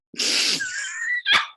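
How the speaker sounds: a burst of high-pitched laughter. Real, not acted. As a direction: break into a real, high laugh. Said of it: "I just started cracking up".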